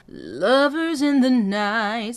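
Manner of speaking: slow sung, anchored down on lovers; ground down on lovers. Shown as a demonstration. Coach showing anchor on lovers. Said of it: "using the word lovers".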